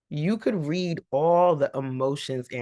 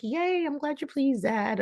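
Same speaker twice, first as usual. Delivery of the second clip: high, squeaky mock-eager son voice; mock cheerful son; 11 semitones higher than usual. Son to his father, fake cheer, high and squeaky. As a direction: pitch up into a falsely sunny young man.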